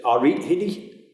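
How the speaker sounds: a friendly Geordie hello; Geordie accent. Shown as a demonstration. Teacher demonstrating a Newcastle greeting in Geordie accent. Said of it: "in Newcastle, you say".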